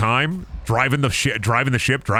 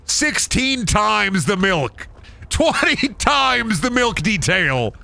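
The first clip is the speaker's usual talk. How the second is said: mock keynote pitch; 8 semitones higher, much slower than usual. Parodying Todd Howard's game pitch.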